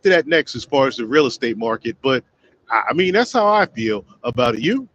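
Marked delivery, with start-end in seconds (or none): none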